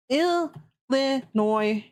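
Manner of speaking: "Illinois" slowly, syllable by syllable; sounding it out. Real, not acted. Streamer looking up the origin, "Illinois" slowly sounded out. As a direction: stretch "Illinois" into ill-li-noy. Sounding out the word while searching.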